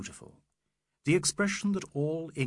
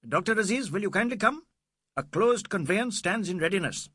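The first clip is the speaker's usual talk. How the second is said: high, louder officious summons; shrill police inspector; 8 semitones higher, 4 dB louder than usual. A narrator's reading. Inspector asking Aziz to come to the conveyance, shrill. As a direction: summon him formally, pitched high. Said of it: "said in shrill tones".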